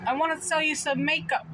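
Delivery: a hint of an eager old seller; old man pitch. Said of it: "he's probably in his seventies or eighties".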